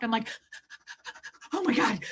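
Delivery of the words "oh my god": hushed panting into a higher gasped cry; mock out of breath. Streamer acting out running back for a dropped thing, winded. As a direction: pant as if running back a mile. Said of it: "feel like I'm actually running".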